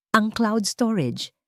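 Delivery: plain reading voice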